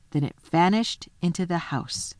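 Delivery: plain reading voice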